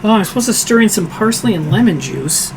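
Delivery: normal talking voice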